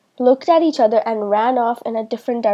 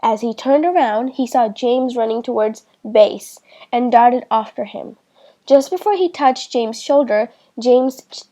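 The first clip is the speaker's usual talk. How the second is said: plain reading voice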